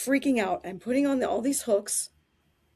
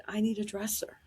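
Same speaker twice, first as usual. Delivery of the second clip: hushed, breathy request; whispered demand; 6 dB quieter than usual. Retold or imitated. Diva grabbing helper, whispers a demand. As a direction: whisper it close to the ear. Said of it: "she grabbed me and said".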